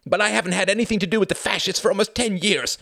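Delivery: stammered start building to loud outrage; indignant defence. A narrator's reading. The Count answers Jorgen about fascists, indignant outburst.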